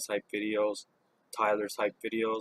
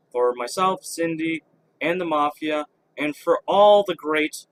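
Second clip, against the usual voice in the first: louder outro shouted over noise; yelling over helicopter; 4 semitones higher, 8 dB louder than usual. Real, not acted. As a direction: raise your voice over a helicopter. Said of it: "sorry for the helicopter noises".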